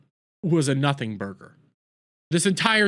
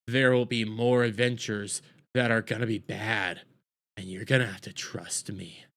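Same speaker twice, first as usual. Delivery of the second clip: low, hushed mock-ominous narration; ominous narrator; 6 semitones lower, 5 dB quieter than usual. Mysterious woman warning viewers of dark adventures, mock ominous. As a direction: drop low and soft like a foreboding storyteller. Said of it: "This is gonna be a whole lot darker".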